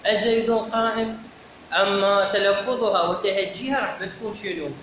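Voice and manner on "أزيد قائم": "أزيد قائم" asked on a higher, level pitch; example question. Shown as a demonstration. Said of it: "اللي هي إيه".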